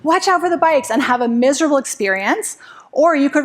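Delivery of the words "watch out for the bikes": higher, louder warning shout at a husband; anxious shout of warning, pitched high; pretend shouting. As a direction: call out a nervous warning to a husband on a bike. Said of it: "start shouting to your husband".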